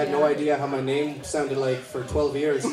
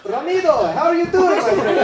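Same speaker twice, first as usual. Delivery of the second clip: high, loud, sing-song hello; bubbly high greeting to a patient; cheery doctor greeting; 11 semitones higher, 6 dB louder than usual. Retold or imitated. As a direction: greet him like an old pal, pitched way up.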